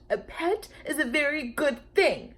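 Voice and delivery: high, pointed quoting of his words; snippy quoting. A narrator's reading. Lulu quoting Mister B back at him, snippy. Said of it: "in a very snippy voice".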